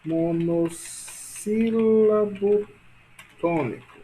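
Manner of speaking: very drawn-out syllables, pause before last word; slow dictation. Shown as a demonstration. Teacher writing the term for class, slow dictation. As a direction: dictate the term bit by bit, stretching it.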